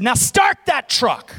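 loud, slow, drawn-out order; re-enacted commanding preacher; bold command. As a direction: order it with full confidence.

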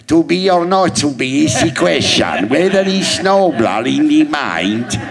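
mock theatrical Hamlet in a funny accent; funny Shakespeare accent. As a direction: ham up Hamlet in a silly foreign accent. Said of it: "to her, I sounded like".

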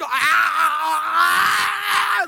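long high wail, rising; imitated scream. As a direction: mimic a drawn-out high scream. Imitating the girl's scream in church.

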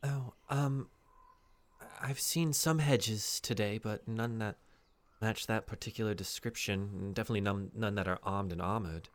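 lightly voiced NPC. NPC answers player in character.

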